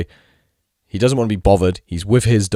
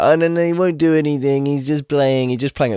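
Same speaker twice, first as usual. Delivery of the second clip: high, louder sing-song mock owner; mock-sweet voice of a dog owner brushing it off; mocking impression; 6 semitones higher, 4 dB louder than usual. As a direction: parody the dismissive owner. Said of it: "she keeps being like".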